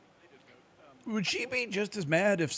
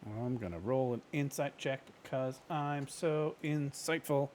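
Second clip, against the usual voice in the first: ordinary delivery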